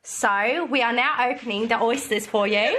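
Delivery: put-on Australian, presenter-like; amateur Australian host; Australian accent.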